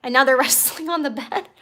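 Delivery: ordinary delivery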